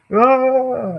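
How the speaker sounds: high, loud cry of someone falling; mock falling scream. Someone falling without a parachute, high loud mock scream. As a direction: scream as if dropping through the air.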